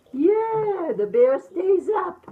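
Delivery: high-pitched, gleeful refrain; excited cheer. Acted. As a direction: cheer it with a rising finish.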